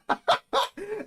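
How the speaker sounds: loud, high burst of laughter; real hearty cackle; delighted laugh. Real, not acted. Laughs at viewer's message.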